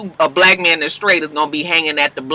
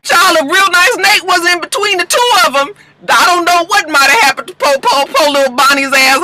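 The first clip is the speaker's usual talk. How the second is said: much higher, louder, fast, gossipy shriek; excited squeal; 12 semitones higher, 4 dB louder than usual. Real, not acted. Host cheering on Annalise's garage fight, high excited shriek. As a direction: squeal at the drama like a thrilled fan.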